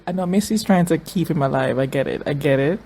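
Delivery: usual speaking voice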